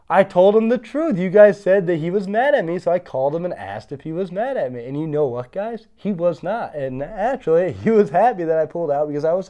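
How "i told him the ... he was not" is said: indignant retort. Retold or imitated. Retort to managers who lied.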